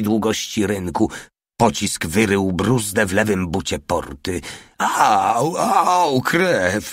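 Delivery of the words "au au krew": high wailed ow, acted; howling in pain. A narrator's reading. Albert hit by a ricochet in the cheek, howling. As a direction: yelp and wail over the wound. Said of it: "wył Albert".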